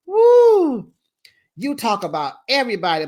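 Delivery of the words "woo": very high, loud whoop; woo cheer. Real, not acted. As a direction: whoop like a fan. Cheering the burn.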